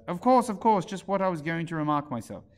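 impatient dormouse. A narrator's reading. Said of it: "impatiently and said".

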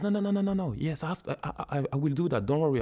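high-pitched, rapid string of refusals; mock insistent offer to help; insistent reassurance. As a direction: imitate someone brushing off every objection. Acts out Jim King insisting.

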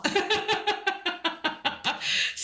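long high giggle; laughing. Real, not acted. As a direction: dissolve into high, rapid laughter. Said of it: "it makes me laugh".

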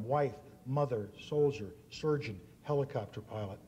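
ordinary talk

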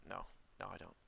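ordinary talk, nothing marked

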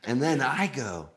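usual speaking voice

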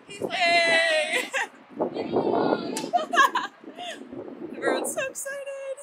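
high, loud giddy squeal; excited squeal. Real, not acted. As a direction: squeal with delight. Squealing at museum entrance.

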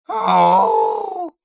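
very high, loud sustained shriek; piercing shriek. A narrator's reading. Dark thing leaping at man, shrieking. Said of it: "came the shriek from the thing".

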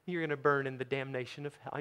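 soft, mock-gentle threat; mock-nice. Shown as a demonstration. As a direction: deliver doom in a nice, quiet tone. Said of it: "can't say that nicely".

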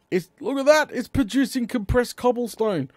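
high-pitched, thrilled exclamation; excited. Real, not acted. Gamer seeing the machine produce cobblestone, excited. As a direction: marvel loudly at it working.

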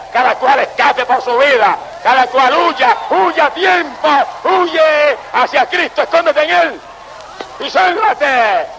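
a preacher urging listeners to flee to Christ, rising to a slow shout; building preaching cry. Acted. Preaching climax, rising call.

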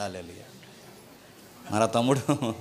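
normal talking voice

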